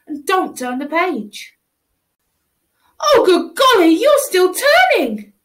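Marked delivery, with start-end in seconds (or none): 3.0-5.3 high, loud, near-tearful cry of dismay; loud high dismayed wail; distressed wail. Acted. Child wails at page turn.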